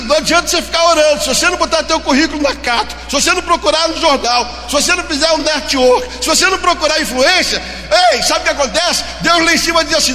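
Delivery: loud, high fervent preaching; preaching at full force. Acted. Pastor exhorting the unemployed, preaching loudly.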